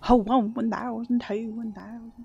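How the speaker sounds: exaggerated Southern-accented count; a hint of mock Southern counting. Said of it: "in a Southern accent".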